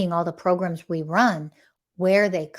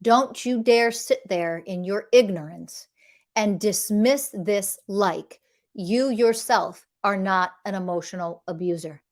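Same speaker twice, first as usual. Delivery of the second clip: ordinary talk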